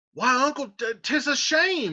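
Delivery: high, slowed protest; aggrieved Tybalt. Acted. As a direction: nephew protesting the shame. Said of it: "Tybalt says".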